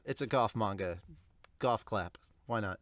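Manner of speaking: usual speaking voice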